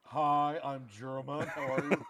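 put-on casual, deliberately botched German accent; wrong German accent. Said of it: "Just do wrong accents for everything".

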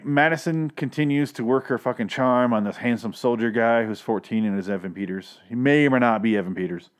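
usual speaking voice

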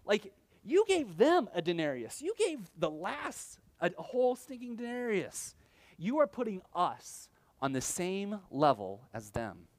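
high, outraged complaint of an underpaid worker; indignant protest. Retold or imitated. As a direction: workers griping to the master about equal pay, indignant. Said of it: "They're like".

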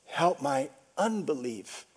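low, soft plea; pleading. Retold or imitated. Father confessing doubt to Jesus, soft pleading. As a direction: plead softly, letting the voice sink. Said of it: "he pleaded".

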